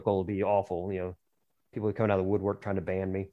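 ordinary talk

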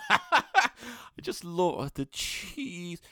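very slow, high, laughing; dreamy cheese lad. Retold or imitated. Cheese lad gushing about cheese while laughing, very slow and high. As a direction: savour every word like a blissed-out lad. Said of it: "imitating cheese lad".

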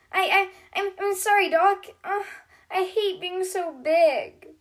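high, stammering, slowing sadly at the end; a mild touch of upset; stammered apology. A narrator's reading. Bronte apologizing to Doc after hurting the toys.

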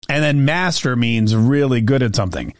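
usual speaking voice

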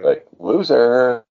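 slow, drawn-out sneer; drawn-out mocking loser; mocking taunt.

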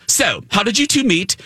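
a touch of haughty small talk. Retold or imitated. Villainess questions fans while walking, mildly sassy. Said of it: "she was so sassy".

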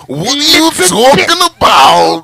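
very high, loud gravel-voiced shout; Macho Man voice. Voicing Macho Man at his baby mom. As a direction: yell it like a wrestling promo. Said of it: "imitate his voice".

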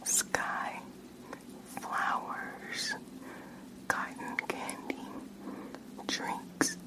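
faint far-off whisper into one ear; distant test whisper; soft whisper. Shown as a demonstration. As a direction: breathe each word softly into the ear. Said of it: "me whispering in your ears like the last one".

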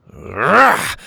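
short, loud growled yell; lunging roar. A narrator's reading. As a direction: roar as you grab someone. Captain lunges at Petey.

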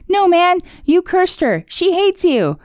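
put-on high boyish Finn. Finn voiced in retold episode.

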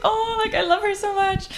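high, teary voice, half-sobbing it; mock crying. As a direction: retell his gushing through mock tears. Fan overcome by meeting his idol.

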